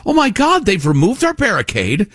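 rising, high whimpering mockery; mock crying protester. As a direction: whine it like a panicked child. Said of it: "you cry like".

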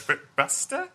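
put-on high-pitched British accent slipping into Russian; botched British accent. Quoting a film's bad British accent, Russian-sounding. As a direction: attempt British, land oddly Russian. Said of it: "The weird Russian one that you're doing".

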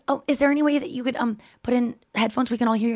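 tentative, apologetic asking; timid repeated request. Retold or imitated. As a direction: ask carefully, trying not to offend. Anya timidly repeats her request.

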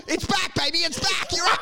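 high-pitched excited sales cry; jubilant salesman. Acted. Broker telling client the money is back, jubilant. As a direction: announce a miraculous comeback. Acted broker announces stock recovery.

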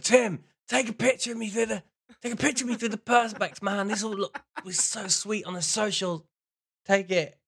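put-on high, eager drunken-night plea; hammy, over-keen request; mock excited demand. Acting out drunk photo demand.

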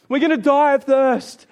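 loud, high-pitched mock wail; mock grumbling. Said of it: "they grumbled some more".

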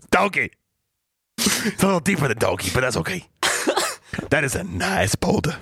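high, laughing donkey impression; hammed-up talking donkey. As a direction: play the comic donkey, cracking yourself up. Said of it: "This is my donkey accent".

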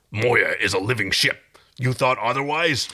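lightly voiced gruff warrior; Dargo growl. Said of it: "Dargo growled".

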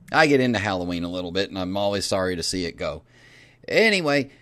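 normal talking voice

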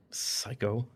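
soft and curt; under his breath. A narrator's reading. Husband insulting wife under his breath, softly. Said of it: "under his breath with a curt".

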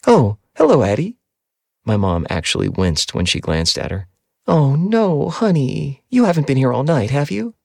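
a fussing mother, high and bright; mom's voice. A narrator's reading. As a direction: mom surprised to find Addie there. Mom voiced in story.

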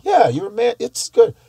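lightly voiced foreign woman approving; imitating Brazilian women. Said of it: "They're like".

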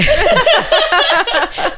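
long, high, loud laugh; real laugh. Real, not acted. Friends joking about crying, loud high laughter. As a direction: break into a big high laugh. Laughs after being told don't cry.

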